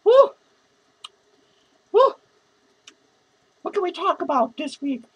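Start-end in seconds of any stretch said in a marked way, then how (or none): 0.0-0.3 short loud woo; whoop. Real, not acted. Repeated drunken whoops. 1.9-2.1 short loud woo; whoop. Real, not acted. Repeated drunken whoops.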